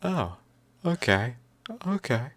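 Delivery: high, settling critter voice; calming animal voice. Retold or imitated. Petted animal calming down in high voice. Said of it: "it's like".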